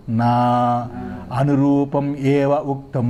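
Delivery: low, slow intoned reply; Sanskrit text recitation. Acted. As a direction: draw the words out on one low note. (సంస్కృత పఠనం, సాగదీస్తూ)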